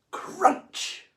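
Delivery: high loud crunch. A narrator's reading. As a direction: snap out the bite. Shark bites.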